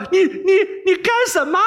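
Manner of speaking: high panicked stutter; stammered scared shout. Acted. Boy wakes to the princess cutting him, high frightened stammer. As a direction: yelp it in fright, tripping over 你. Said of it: "大叫".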